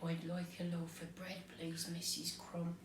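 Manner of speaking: very soft, hushed polite request; quiet. A narrator's reading. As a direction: drop right down and ask softly. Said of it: "then he said quietly".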